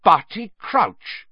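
slow, drawn-out high exclamation; stunned recognition. A narrator's reading. Snape realising who lies there, slow astonished cry. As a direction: say it as the truth dawns. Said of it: "Snape said".

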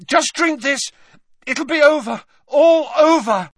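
high, loud, urgent promise; Harry gasping. A narrator's reading. Harry making him drink, gasping desperately. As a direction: push it out desperately in short bursts. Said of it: "gasped Harry".

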